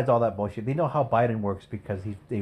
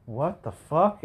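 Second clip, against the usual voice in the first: surprised; 6 semitones higher, much slower than usual. Retold or imitated. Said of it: "I was like".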